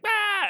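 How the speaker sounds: short high yelp of panic; a quick high scream mid-fight; panicked scream. Retold or imitated. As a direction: yelp in sudden fright.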